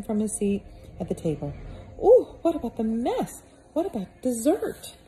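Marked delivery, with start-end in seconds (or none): 2.0-5.0 high, loud put-on boy's voice, worried; fretting boy. Peter dragged from supper, high fretful boy voice. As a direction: whine it like a boy fussing over dessert.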